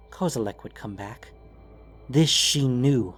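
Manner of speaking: ordinary delivery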